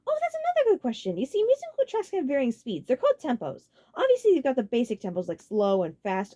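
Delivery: high, loud, enthusiastic lecture; keen to go on a rant; excited Ludwig; eager explaining. A narrator's reading.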